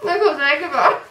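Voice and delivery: cheeks stuffed full. Real, not acted. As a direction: giggle through a stuffed mouth. Laughing with full mouth.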